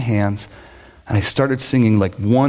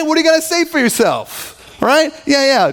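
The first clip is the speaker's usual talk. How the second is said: mock scolding father; 12 semitones higher than usual. Father scolding his prodigal son, high and indignant. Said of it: "does what every good parent would do".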